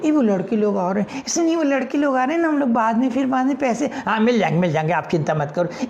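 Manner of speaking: put-on high flustered girlish voices; flustered schoolgirls; panicked girls. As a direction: hurry away nervously.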